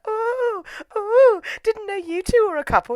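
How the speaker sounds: very high, sing-song "ooh"; teasing friends. Retold or imitated. Her friends teasing the supposed couple, high mock cooing. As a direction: tease them like giggly onlookers.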